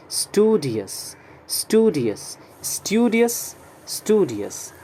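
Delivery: "स्टूडियस" in an American accent, STOO-di-us, no y glide; American "स्टूडियस" with a plain oo; American pronunciation. Shown as a demonstration. Teacher modelling the American accent for the class. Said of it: "American pronunciation है इसका".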